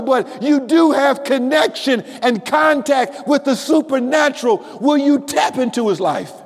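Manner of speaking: preacher lifting the congregation, near singing; preaching at full force. Acted. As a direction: build the sermon to a fever pitch.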